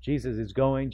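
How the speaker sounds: ordinary talk, nothing marked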